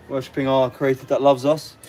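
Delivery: ordinary delivery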